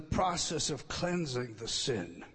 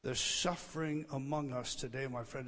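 usual speaking voice

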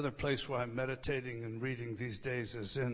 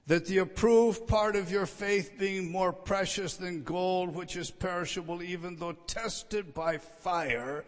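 scripture reading; 5 dB louder than usual. Acted. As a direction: lift the verse up to the congregation. Reads scripture aloud.

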